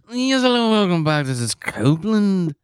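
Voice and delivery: high-pitched parody of the video host's hello; imitating the crazy-voiced host; mocking the YouTuber's greeting. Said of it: "This guy just has a crazy voice".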